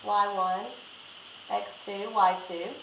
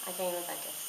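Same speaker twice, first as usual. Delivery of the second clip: heart racing; 4 semitones lower, 12 dB quieter, faster than usual. Real, not acted. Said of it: "My heart is racing".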